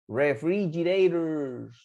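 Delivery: "Refrigerators" slow and stretched; pronunciation model. Shown as a demonstration. Teacher showing students how to pronounce it, slowly drawn out. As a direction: say "Refrigerators" slowly for learners to copy. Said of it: "¿Podrán pronunciar ustedes esa palabra?".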